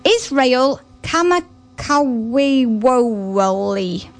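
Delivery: "[name]" said slowly, syllable by syllable; careful pronunciation. Shown as a demonstration. Radio host introducing the next song, careful name. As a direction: spell the name out with care. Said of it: "I think I pronounced that correct".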